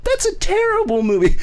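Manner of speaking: very high, shrieked mock outrage; a hammed-up squeal of disgust; mock indignant mom. Mom condemning the edited movie, very high mock indignation. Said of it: "And she's like".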